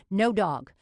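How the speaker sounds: loud, angry refusal; snarling furious shout. A narrator's reading. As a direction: snarl it out in fury.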